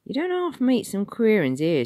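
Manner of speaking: a cheeky city official quizzing a stranger; high Cockney ticket man. Acted. Ticket man questioning Tom, high and loud.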